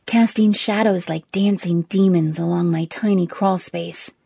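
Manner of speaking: plain reading voice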